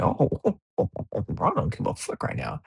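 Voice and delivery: high, giddy laughing rush of oh oh oh; laughing disbelief. Retold or imitated. Fan watching LeBron drop his headband, laughing high. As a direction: laugh through it as if you can't believe what you're seeing. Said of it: "I'm like".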